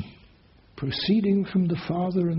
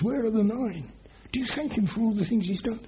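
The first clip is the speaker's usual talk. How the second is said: usual speaking voice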